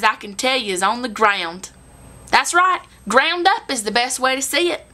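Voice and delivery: hammed-up down-home storytelling, punchlines stretched; folksy country storyteller. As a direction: play it up as a chatty country gal addressing y'all. Performs a comic monologue.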